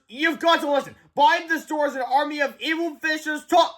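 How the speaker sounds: put-on alarmed boy warning; urgent cry. A narrator's reading. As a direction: beg to be heard. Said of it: "cried George".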